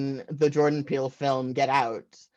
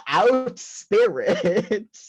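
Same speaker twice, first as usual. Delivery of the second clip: slow, loud banishing cry; mock exorcism; 6 semitones higher, 5 dB louder, much slower than usual. Imagined mom banishing a ghost, slow mock exorcism. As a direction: drive the spirit out, slowly. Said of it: "What I heard was".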